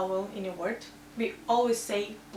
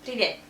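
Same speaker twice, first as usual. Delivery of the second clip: "Привет" with a clear YE in the last syllable; stressed Е. Shown as a demonstration. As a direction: say "Привет" as pree-VYET. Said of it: "This Е is stressed, so we say Е".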